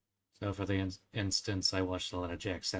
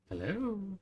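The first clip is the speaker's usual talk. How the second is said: bright high greeting; 11 semitones higher than usual. Real, not acted. Greeting new viewers.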